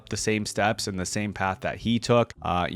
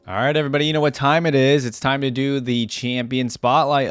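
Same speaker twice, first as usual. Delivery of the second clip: hype host intro; 4 semitones higher, 4 dB louder than usual. Acted. Hosts segment intro to viewers.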